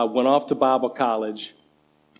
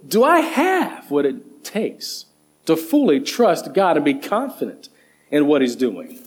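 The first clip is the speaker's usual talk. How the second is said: usual speaking voice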